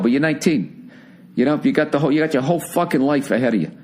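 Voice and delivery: normal talking voice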